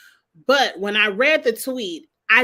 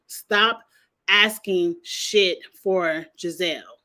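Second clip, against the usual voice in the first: ordinary delivery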